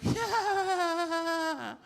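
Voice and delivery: high, loud unhinged yeah; a crazed friend grinning out one word; psychopath yeah. Retold or imitated. Friend answers the joke in a high crazed voice. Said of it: "laughing like a psychopath".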